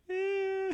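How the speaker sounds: high, drawn-out wavering "eh"; long high hedging sound; noncommittal "eh". Acted. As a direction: draw out a so-so noise, high and unsure. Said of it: "kind of like a come see, come saw sort of thing".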